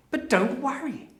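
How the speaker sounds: normal talking voice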